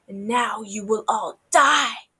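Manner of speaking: slow, loud villainous death threat; menacing threat. Acted. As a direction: draw it out and build to a loud finish. Tiger threatens to kill children.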